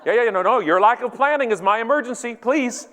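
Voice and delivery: high-pitched, impatient sarcasm; mock indignation. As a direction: sarcastically wave someone through. Acted airport line quarrel.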